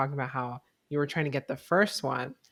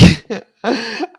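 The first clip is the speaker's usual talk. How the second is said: burst of laughter; 8 semitones higher, 11 dB louder than usual. Real, not acted. Tech glitch mid-recording.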